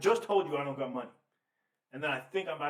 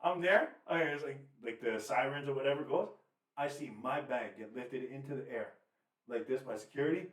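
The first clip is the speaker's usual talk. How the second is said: ordinary talk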